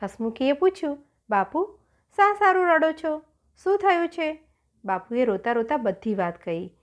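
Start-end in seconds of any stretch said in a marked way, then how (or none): 1.3-4.4 high, anxious questioning; concerned. Acted. Daughter asks her weeping father what happened, concerned. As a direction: plead to know what's wrong.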